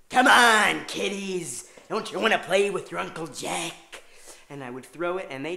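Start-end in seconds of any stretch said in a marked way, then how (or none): 0.1-4.0 loud, high, drawn-out villain taunt; menacing killer taunt. Retold or imitated. Babysitter taunting kids like the Shining killer. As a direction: yell the taunt through the door. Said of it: "I'd be like".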